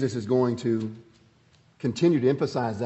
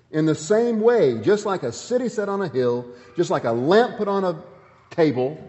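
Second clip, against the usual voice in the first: rising preacher's call; 5 semitones higher, 4 dB louder than usual. Acted. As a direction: lift it up to the congregation. Preacher building the point.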